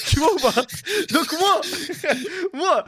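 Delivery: loud, high, laugh-broken delivery; laughing while talking. Real, not acted. Streamer telling friends of getting thrown out, laughing. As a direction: struggle to get the words out through laughter.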